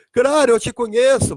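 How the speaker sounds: high, exclaiming reply; elated recognition. Acted. Daniel recognising Jesus in paradise, elated exclamation. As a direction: burst out as you recognise him.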